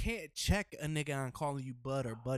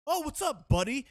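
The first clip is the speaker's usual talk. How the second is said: lightly mocked, high-pitched greeting; gym guy; 8 semitones higher than usual. Gym guy greeting him as buddy, lightly mocked in a high voice. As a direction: imitate an overfriendly greeting, pitched up.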